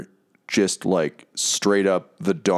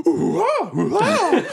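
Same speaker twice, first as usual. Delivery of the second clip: high hooting ape calls; ape noises; 3 dB louder, slower than usual. Acted. The ape demon hoots, high ape calls. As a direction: hoot like a big ape. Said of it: "the creature's like".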